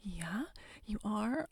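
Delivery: normal talking voice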